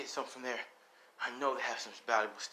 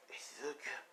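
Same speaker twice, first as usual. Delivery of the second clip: faint whispered reassurance, much softer; 4 semitones higher, 10 dB quieter than usual. Acted. Dying mother to son Izuku, whispering softly. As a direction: barely breathe the words out.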